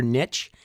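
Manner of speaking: niche as "nitch"; older pronunciation. Shown as a demonstration. Host recalls the old way, niche said "nitch". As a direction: say niche to rhyme with rich. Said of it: "Remember when it was a niche".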